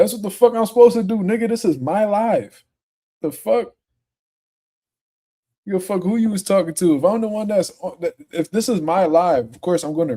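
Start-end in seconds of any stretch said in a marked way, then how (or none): 0.0-7.0 heated retort. Real, not acted. Streamer snapping at a commenter who complained he reads comments, heated and indignant. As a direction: fire it back at the commenter.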